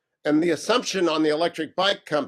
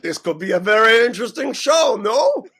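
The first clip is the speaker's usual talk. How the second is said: high, loud mock French accent; hammy Frenchman with a rising "no?"; put-on French accent, amused; 5 semitones higher, 5 dB louder than usual. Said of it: "use a French accent".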